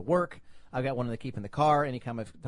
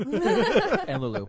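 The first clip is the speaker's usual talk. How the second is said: laughing shout; 10 semitones higher, 7 dB louder, much slower than usual. Real, not acted. Joking banter, laughing.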